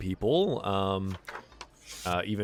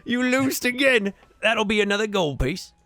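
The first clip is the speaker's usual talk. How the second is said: gloating barmaid; 7 dB louder than usual. Acted. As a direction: crow over the beaten thug, high and loud. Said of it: "slams the hand ... and goes".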